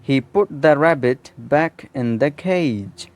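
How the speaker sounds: a deliberate dictation pace; slow example sentence. Shown as a demonstration. Teacher reading a model sentence slowly to students. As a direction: read slowly, every word clear. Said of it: "उसने rabbit को दोबारा".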